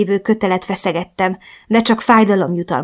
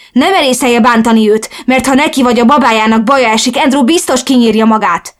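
loud, higher, furious warning; yelling; 5 semitones higher, 6 dB louder than usual. A narrator's reading. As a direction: yell at the captor to back off. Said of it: "ordította". (dühösen ordítva)

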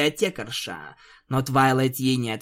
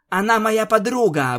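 panicked; 8 semitones higher, 5 dB louder than usual. Acted. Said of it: "быстро задышала Твайлайт".

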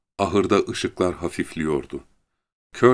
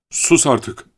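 raised, higher-pitched angry order; furious; 6 semitones higher, 4 dB louder than usual. A narrator's reading. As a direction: demand silence angrily. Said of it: "Fena halde öfkelenmişti". (öfkeyle susturarak)

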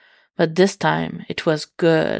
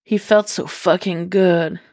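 usual speaking voice

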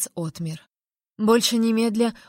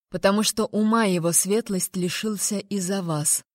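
ordinary delivery

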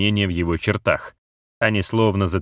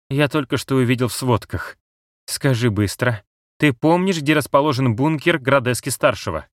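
higher, tense cat voice; urgent cat voice; 6 semitones higher than usual. Acted. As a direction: press him for an answer fast. Cat character voice.

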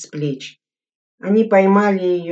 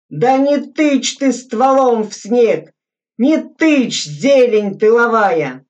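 loud, higher, slow and cross; scolding yell; 5 semitones higher, 6 dB louder, slower than usual. A narrator's reading. Veteran scolding a rookie about his barrel, angry yell. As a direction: tell the greenhorn off hard.

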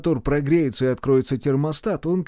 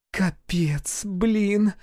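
higher, whispery grumble; disgusted, pitched-up cursing; displeased; 7 semitones higher, much slower than usual. A narrator's reading. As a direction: complain in a high, airy voice. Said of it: "недовольно поморщилась она".

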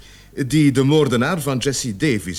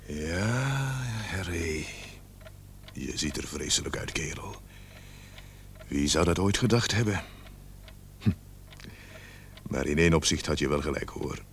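softly, much quieter, talking over a dead friend's body; quiet words to the dead; 6 semitones lower, 10 dB quieter than usual. Acted. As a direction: murmur to the dead man, almost to yourself. Speaks to dead Harry's body. (zacht en ingetogen tegen de dode vriend)

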